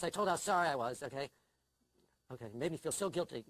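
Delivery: ordinary talk, nothing marked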